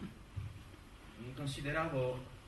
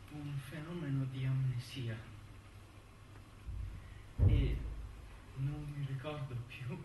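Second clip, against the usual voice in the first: letter reading; 5 dB quieter than usual. Acted. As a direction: read it like a tired man writing a letter. Reads a letter slowly with pauses.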